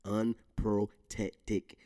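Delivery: Unprotected stretched out syllable by syllable; struggling to say Unprotected; drawn-out stumble. Shown as a demonstration. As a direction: labour through Unprotected very slowly. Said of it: "I can't even get it".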